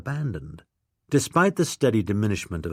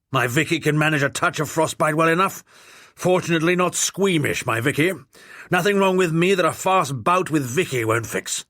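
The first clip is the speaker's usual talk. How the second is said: put-on blustering old lord; 6 semitones higher than usual. A narrator's reading. Old lord demanding who the young man is, blustering. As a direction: bark it like an outraged old lord.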